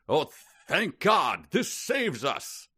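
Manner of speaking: put-on high, slow, relieved old-timey voice; a hammed-up relieved Sylvanus P Thompson; Sylvanus P Thompson impression. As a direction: draw out the thanks like a Victorian gentleman. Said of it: "you sound exactly like Sylvanus P Thompson".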